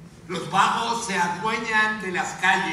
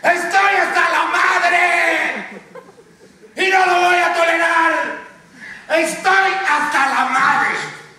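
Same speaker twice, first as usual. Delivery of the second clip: angry shout; 6 semitones higher, 4 dB louder than usual. Acted. Anchor leading viewers at their windows, angry shout. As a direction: yell it like a rallying cry. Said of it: "por la ventana y griten".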